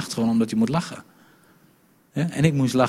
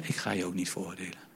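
gentle reassurance; 5 semitones lower, 10 dB quieter, faster than usual. Acted. Jesus reassures the accused woman.

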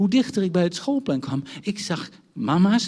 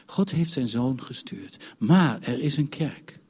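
ordinary talk, nothing marked

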